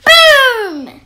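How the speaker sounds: booming sound effect shout; a big loud BOOM. A narrator's reading. Storyteller booming to open the tale.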